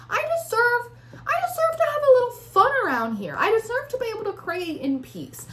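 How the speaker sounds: high-pitched, emphatic plea to be allowed happiness; indignant self-assertion. Acted. Tarot reader voicing the querent's defiance, high indignant plea. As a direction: insist on it, voice pushed way up. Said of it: "the tone of my voice when I say that".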